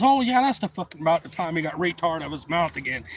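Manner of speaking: mocking taunt. Retold taunting guy.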